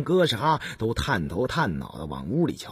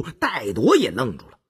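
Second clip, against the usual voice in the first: ordinary delivery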